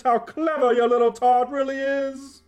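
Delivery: pompous king voice. Acted. King voiced in radio play.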